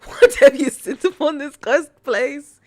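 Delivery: loud, high mock horror at a cursed place; mock dramatic outcry. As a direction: cry out like a doomed maiden.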